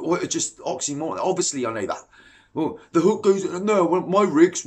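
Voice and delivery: ordinary delivery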